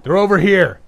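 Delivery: high, loud shout across a distance; full-voiced, high-pitched holler. A narrator's reading. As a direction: call out to alert the mob. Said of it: "He turned and shouted toward the building".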